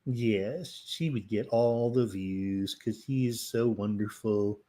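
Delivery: ordinary talk, nothing marked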